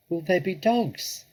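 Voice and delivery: a cub asking in fear; frightened cub. Acted. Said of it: "he said".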